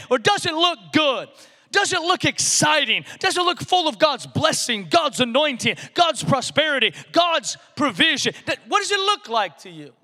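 full-force preaching. Acted. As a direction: preach it out, lifting every question.

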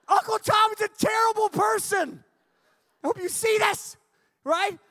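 loud, high playful call-out; mock accusation shout. Real, not acted. Teasing his uncle in front of everyone, loud shout. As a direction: yell it out teasing him.

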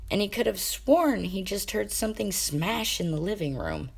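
ordinary delivery

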